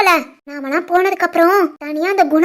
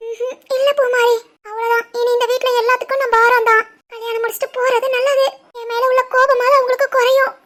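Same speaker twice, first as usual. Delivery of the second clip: tearful; 4 semitones higher than usual. Acted. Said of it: "அழுவ".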